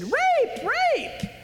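shrill high false cry for help. Retold or imitated. Potiphar's wife crying out to accuse Joseph, high shriek.

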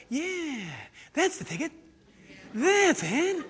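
pleased liar, drawn-out catchphrase; comedy liar character. Acted. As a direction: imitate a TV sketch fibber congratulating himself. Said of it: "He's the guy on Saturday Night Live".

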